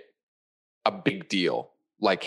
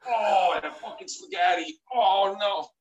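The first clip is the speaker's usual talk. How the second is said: much higher, wailing mock death throes with cries and shouts; hammed-up dying anguish; 12 semitones higher than usual. Reprising the spaghetti heart attack for a friend, hammed-up wailing. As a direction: ham up a death scene, wailing over the spaghetti. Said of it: "I'm so glad you took it as long as you took it".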